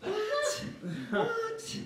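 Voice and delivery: high-pitched, incredulous squeak of surprise; shocked disbelief. Acted. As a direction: recoil in mock shock. Acted shock at doctor's question.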